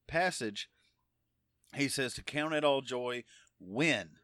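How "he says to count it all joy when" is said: "when" set apart and punched; stress on when. Shown as a demonstration. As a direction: lean hard on "when", then pause. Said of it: "the emphasis is on when".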